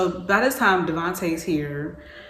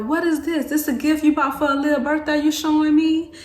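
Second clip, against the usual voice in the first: high, louder mock suspicious mother; a hint of a suspicious mom, pitched up; 9 semitones higher, 6 dB louder, faster than usual. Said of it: "my mama was like".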